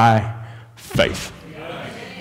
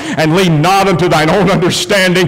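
slow, ringing recitation building higher; scripture declared from the pulpit; preached scripture; 5 semitones higher than usual. Acted. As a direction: proclaim the verse, climbing to a drawn-out finish.

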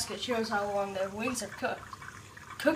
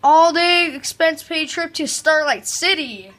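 higher, louder announcer voice; game announcer; 6 semitones higher, 10 dB louder than usual. Acted. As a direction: call it out like a game show host. Reads game announcer line aloud.

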